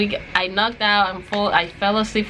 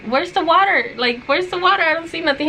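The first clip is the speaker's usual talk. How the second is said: confused; 7 semitones higher than usual. Retold or imitated. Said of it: "Me and Alex were like".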